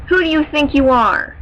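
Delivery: angry shout. Acted. Warrior slashing at her father, shouting in fury. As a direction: hurl the question as you strike.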